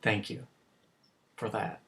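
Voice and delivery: low, very soft gratitude; hushed, heartfelt thank-you; humble thanks. Acted. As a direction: offer it quietly and sincerely. Father humbly thanks son's boyfriend.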